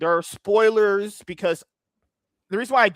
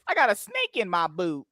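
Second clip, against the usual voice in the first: put-on old grandma; 4 dB quieter than usual. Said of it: "If the old grandma".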